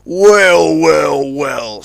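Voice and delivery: loud drawn-out menacing well well well; sly villain. A narrator's reading. Crook tug Johnny Cuba spotting Zebedee, sly. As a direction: savour each well like a crook.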